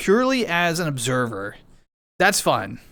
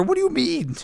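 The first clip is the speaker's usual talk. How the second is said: mock outrage; 11 semitones higher than usual. Real, not acted. Reacting to chat accusation.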